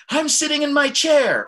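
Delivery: high, loud, excited outburst. Shown as a demonstration. Teacher showing the emotion behind the words, excited. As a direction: exclaim it with a huge lift in pitch. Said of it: "emotion that's behind what I'm saying".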